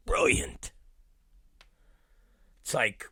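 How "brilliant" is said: whispered Leanne impression. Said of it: "she says".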